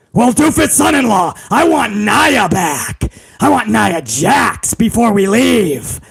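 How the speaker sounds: hammed-up irate boss impression; Vince McMahon. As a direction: bellow for the son-in-law like an overbearing boss. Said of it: "Vince McMahon was like".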